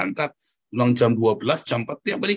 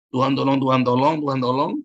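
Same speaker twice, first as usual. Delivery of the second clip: hurried prayer; 3 dB louder than usual. Retold or imitated. Preacher mimics believers praying in haste, hurried prayer. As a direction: imitate a rushed, repetitive plea. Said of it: "tapi terburu-buru Anda berdoa".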